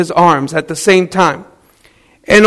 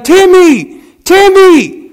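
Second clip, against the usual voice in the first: put-on high, loud frantic call of a boy's name; yelling Timmy; 11 semitones higher, 4 dB louder, slower than usual. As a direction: scream for your son across the yard. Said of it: "and she's yelling".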